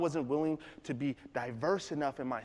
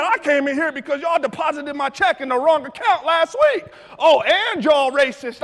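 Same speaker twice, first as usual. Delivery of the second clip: loud, high, indignant rant; offended customer; 10 semitones higher, 12 dB louder than usual. Retold or imitated. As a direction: imitate an irate customer shouting. Customer rants about wrong account.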